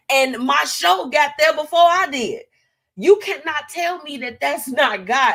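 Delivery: loud, high, excited testimony; excited shout. Real, not acted. Host telling listeners God got the show to Houston, excited shout. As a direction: proclaim it like a praise break.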